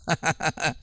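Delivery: short quiet chuckle; laugh. Real, not acted. Preacher joking about asking the neighbours, quiet chuckle. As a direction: chuckle at your own joke.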